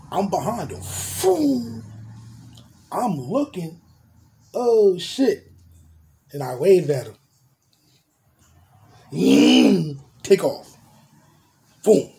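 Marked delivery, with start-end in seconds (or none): 0.8-1.8 whoosh. Retold or imitated. Car zooming past. 9.1-12.1 revving takeoff. Retold or imitated. Car taking off fast.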